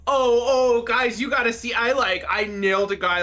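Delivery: excited bragging. Acted. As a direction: call everyone over, bursting to show off. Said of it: "is, like, gesturing to everyone".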